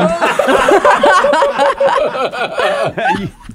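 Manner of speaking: loud high-pitched burst of laughter; long, helpless real laugh; big laugh. Real, not acted. Reaction to biting banana's butt end.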